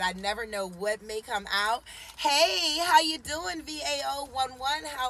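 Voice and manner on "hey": ordinary delivery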